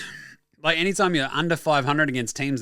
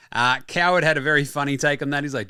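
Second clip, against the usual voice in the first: usual speaking voice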